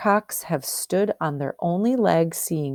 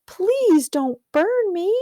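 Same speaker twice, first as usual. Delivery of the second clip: frightened plea; slower than usual. Acted. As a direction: beg the fire for your life. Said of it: "he said".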